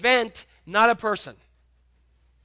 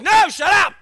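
angry shout; 10 semitones higher, 12 dB louder than usual. Retold or imitated. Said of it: "He's like".

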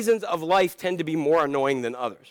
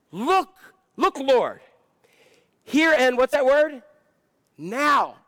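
eager Zacchaeus; 6 semitones higher than usual. Acted. Preacher acts out Zacchaeus pledging.

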